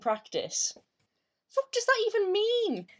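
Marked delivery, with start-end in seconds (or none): 1.5-2.9 incredulous. Real, not acted. Exasperated outburst over jargon.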